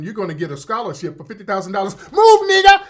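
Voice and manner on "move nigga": put-on very high, loud Nigerian accent; hammed-up, shouted Nigerian accent; mock Nigerian accent. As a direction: belt out an acceptance speech in a put-on Nigerian accent. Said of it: "Mister Nigerian".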